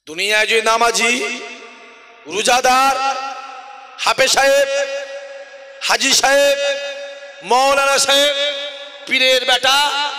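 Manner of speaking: loud roll-call, each title held out; ringing, emphatic sermon oath; full-force preaching. Real, not acted. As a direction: proclaim it to the whole congregation.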